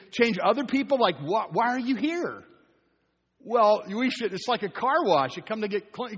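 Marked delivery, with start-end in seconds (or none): none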